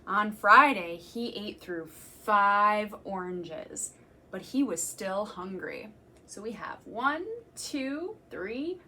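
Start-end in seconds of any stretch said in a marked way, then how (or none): 0.1-5.9 slow, emphatic storytime reading; reading to children. Acted. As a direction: savour each food for the little listeners. Reading picture book to children.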